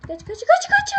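high, loud frantic shout to run; terrified gamer shouting to run; panicked yell. Real, not acted. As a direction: yell at yourself to flee in panic. Fleeing monster in horror game.

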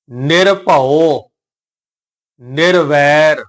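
ਨਿਰਭਉ, pause, ਨਿਰਵੈਰ, each very slow; separated pronunciation. Shown as a demonstration. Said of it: "ਇਹਨਾਂ ਨੂੰ ਅਲੱਗ-ਅਲੱਗ ਉਚਾਰਨ ਕਰਾਂਗੇ". (ਨਿਰਭਉ, ਨਿਰਵੈਰ ਅਲੱਗ-ਅਲੱਗ, ਲਮਕਾ ਕੇ)

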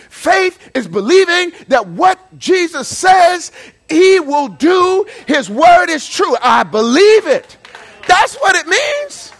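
loud preacher's call, slow and emphatic; full-force preaching. Acted. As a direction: preach it hard to the whole congregation. Preaching faith, shouting.

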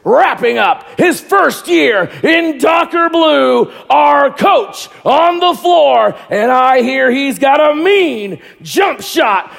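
slow, shouted arena player intro; hype man voice. Acted. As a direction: stretch every word like a starting-lineup call. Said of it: "I'm gonna put my big hype man voice here".

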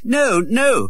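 put-on high boy-prince protest; crying out in protest. A narrator's reading. Prince denying Canty is his father, high protest. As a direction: refuse it outright. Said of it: "cried Edward".

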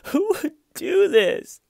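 high, loud fake sobbing whine; imitating Stiven's phony crying; mock lie cry. Commentator mocking the suspect's fake crying, high whiny sob. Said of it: "is crying and going".

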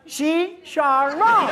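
hammed-up gringo "Chicharrón"; loud slow demo. Shown as a demonstration. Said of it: "¿Qué puede pedir?". ("Chicharrón" lento y fuerte)

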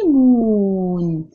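ليمون drawn out slowly, every sound clear; ليمون said very slowly for first graders; slow demo word. Shown as a demonstration. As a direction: spell out ليمون slowly for young pupils. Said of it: "آه زي كلمة".

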